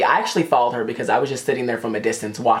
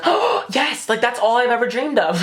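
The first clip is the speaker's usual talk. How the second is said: high, gushing mock delight with a gasp; fake excitement; 9 semitones higher than usual. [name] playing the psychic along, fake excited. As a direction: overplay a thrilled yes. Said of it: "makes it seem like she's excited".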